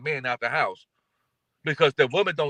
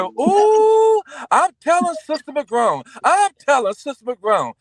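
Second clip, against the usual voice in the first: high, loud singsong tattle; tattling sister; 11 semitones higher, 5 dB louder, slower than usual. Retold or imitated. Sister threatening to tell on the kids. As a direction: squeal it like a little sister telling on you.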